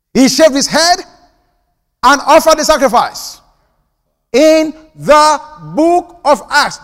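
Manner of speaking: loud, high, slowing climax; preaching emphasis. Real, not acted. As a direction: drive it home, slowing at the end.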